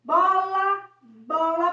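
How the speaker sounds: "Bola" said loud with a strong B; articulated demo. Shown as a demonstration. Teacher showing the child the mouth on "bola", loud and exaggerated. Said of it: "olha a boca".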